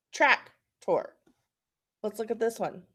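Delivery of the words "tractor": "Tractor" with a full "or" ending, TRAK-tor; "Tractor" split up, second part like "tore"; sounded-out tractor. Shown as a demonstration. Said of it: "my short vowel".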